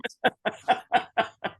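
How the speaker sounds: a real high laugh; short real laugh. Real, not acted. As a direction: laugh at the memory. Laughs about the pot.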